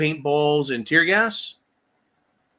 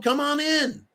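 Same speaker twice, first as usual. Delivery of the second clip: quoted invite pitched much higher; mock invitation; 10 semitones higher, slower than usual. Streamer retelling what the crowd was told, high mock invitation. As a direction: imitate a welcome, high-pitched and insistent.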